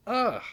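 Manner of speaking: loud high groan of disgust; gross-out groan; disgusted ugh. Real, not acted. Disgusted at the cover.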